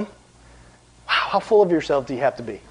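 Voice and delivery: ordinary talk, nothing marked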